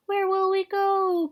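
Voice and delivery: put-on high, slow wail of young birds; crying out. A narrator's reading. Cygnets ask father about the journey, crying out. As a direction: stretch it out high like whining youngsters. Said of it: "cried the cygnets".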